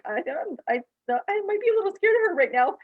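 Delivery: high, half-laughing mock fear; mock scared. Retelling the practitioner's reaction, high mock-scared voice. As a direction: play him pretending to be scared of her. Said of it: "he was like".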